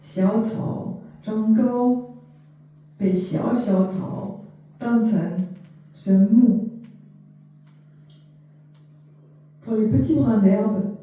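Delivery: measured poetry reading, spaced line by line; poem recitation. Acted. Translator reading short poems to listeners, poem recitation. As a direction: recite it with deliberate pauses between phrases.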